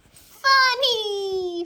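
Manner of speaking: high, loud gleeful cry; high gleeful kid; giggly toddler. Acted. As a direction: toddler delighted with his prank. Toddler laughing.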